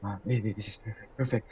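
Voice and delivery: hushed murmur to yourself; whispered aside. Acted. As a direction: whisper it under your breath, barely audible. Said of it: "you see her whisper".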